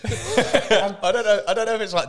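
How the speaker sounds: laughing. Real, not acted. Laughing through spicy heat.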